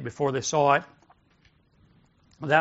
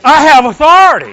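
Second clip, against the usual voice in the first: policeman shouting to halt a crowd; commanding shout; 12 semitones higher, 19 dB louder than usual. Shown as a demonstration. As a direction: shout it high and hard with full authority. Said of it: "speak like a spiritual policeman".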